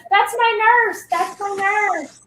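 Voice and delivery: put-on high-pitched kid calling out across a store; excited, high retold shout; imitated excited scream. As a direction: mock-scream it like a thrilled child.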